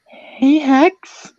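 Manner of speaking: put-on very high, excited girlfriend voice; high-pitched girlish excitement; really excited. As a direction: greet in a squeaky, thrilled voice. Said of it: "She sounded really excited".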